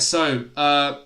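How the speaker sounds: ordinary talk, nothing marked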